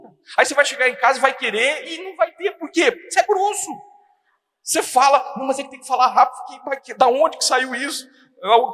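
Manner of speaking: high-pitched, exasperated telling-off; mock scolding. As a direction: scold a friend in comic disbelief. Acted scolding of the rude husband.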